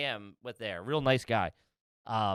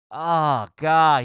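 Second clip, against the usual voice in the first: loud dismayed "Oh, God"; loud groan; 4 semitones higher, 9 dB louder than usual. Retold or imitated. Janitor seeing the mess, loud disgusted groan. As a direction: boom out the disgust. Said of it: "He goes".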